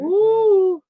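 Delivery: loud high falsetto ooh; a long high ooh; Darren Fleet ooh. Retold or imitated. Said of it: "In my Darren Fleet voice".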